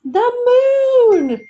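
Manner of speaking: high, loud burst on moon; excited unison cry. Acted. Beanie and Nani blurting out the same idea, excited. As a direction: blurt it out together in delight. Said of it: "they said at the same time".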